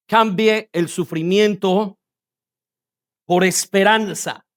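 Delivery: "Cambie" and "sufrimiento" drawn out slowly; slow, deliberate repetition. Shown as a demonstration. Preacher simplifying a seemingly complex phrase, slow and deliberate. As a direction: say "Cambie el sufrimiento por esperanza" slowly, spaced in two parts.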